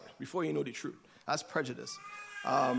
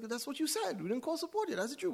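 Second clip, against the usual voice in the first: much higher, indignant self-defence; defensive protest; 12 semitones higher than usual. Retold or imitated. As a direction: insist you only did what you were told. Retold argument with manager.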